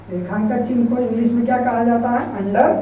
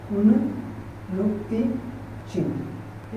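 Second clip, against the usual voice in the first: slow soft repeat; 7 dB quieter, much slower than usual. Shown as a demonstration. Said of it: "teacher repeats term slowly".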